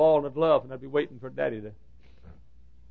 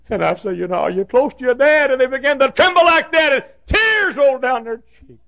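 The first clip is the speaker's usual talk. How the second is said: high, loud preacher climb; emotional sermon peak; preaching shout; 13 semitones higher, 11 dB louder than usual. Acted. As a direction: shout the scene from the pulpit.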